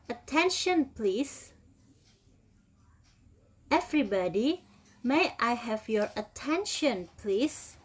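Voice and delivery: slow, clear drill phrases for students to repeat; teacher model reading. Shown as a demonstration. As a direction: model each phrase slowly for the class to copy. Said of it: "Please everybody repeat after me".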